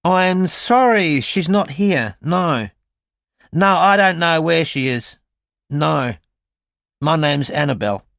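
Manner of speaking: high, slow, put-on Australian accent; a caller fobbed off in stiff fake Australian; strangled, overdone Australian accent; stilted Australian accent. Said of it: "she immediately affected a very stilted Australian accent".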